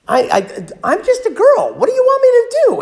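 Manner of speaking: put-on high, loud panicked girl's voice; frightened girl. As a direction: squeal it out like a scared young girl. Esther panics, voiced by preacher.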